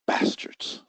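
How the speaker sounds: sly muttered aside about market makers; muttered insult. Real, not acted. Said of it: "excuse me, I didn't say that".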